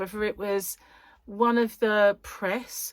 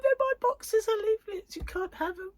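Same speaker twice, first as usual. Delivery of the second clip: high, far put-on voice of someone clinging to her boxes; mock whiny voice; 11 semitones higher than usual. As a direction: play a petulant hoarder, high and squeaky. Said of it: "It'll be like".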